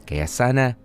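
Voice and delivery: ordinary talk, nothing marked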